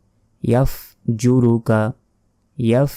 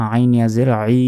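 normal talking voice

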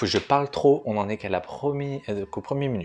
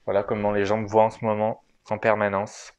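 muffled behind a mask, a little louder at the start; through a face mask; 4 dB louder than usual. Real, not acted. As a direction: talk with a mask over your mouth. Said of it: "en mode masqué". (voix étouffée par un masque)

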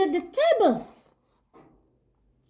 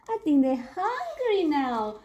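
ordinary delivery

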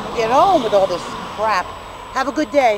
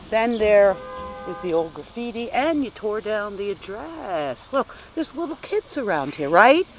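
ordinary talk

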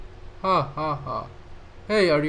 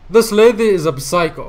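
louder, higher exasperation; exasperated exclaim; 4 semitones higher, 8 dB louder than usual. Real, not acted. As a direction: throw up your hands at her. Exasperated at the game character.

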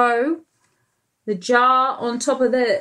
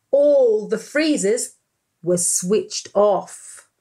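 usual speaking voice

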